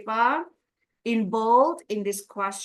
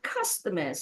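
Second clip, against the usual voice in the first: "Customers" low and soft, still leaning on CUS; falling "Customers", strongest on its first syllable; stress on first syllable; 5 semitones lower, 5 dB quieter than usual. Shown as a demonstration. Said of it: "Stress on the first syllable".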